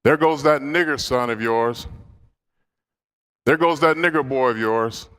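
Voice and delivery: retold yell. Hospice roommate yelling out to his grandmother, retold yell. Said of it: "she used to yell out".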